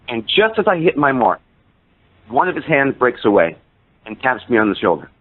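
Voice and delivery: ordinary delivery, nothing marked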